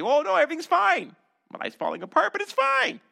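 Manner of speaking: high, loud mock cheerfulness; forced happy denial. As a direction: insist brightly that all is fine, hammed up. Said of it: "have to be happy".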